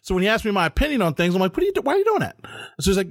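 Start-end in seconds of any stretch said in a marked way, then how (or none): 1.5-2.4 sudden high, incredulous "why are you doing that"; incredulous question. Retold or imitated. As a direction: jump way up in pitch, as if you can't believe it. Confronting friend's odd behavior.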